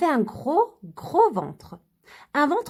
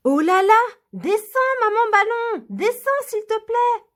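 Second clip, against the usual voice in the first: cri aigu de petite fille inquiète; cri d'enfant; 9 semitones higher, 3 dB louder than usual. Acted. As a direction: appelle sa maman vers le ciel, aigu. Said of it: "s'écria Lily". (Lily crie vers sa maman envolée, voix aiguë)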